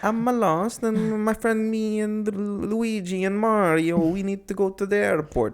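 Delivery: high, slow cartoon Italian accent; butchered Italian accent. Storyteller mimics lost driver asking for airport, butchered Italian accent. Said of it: "I don't wanna do the accent".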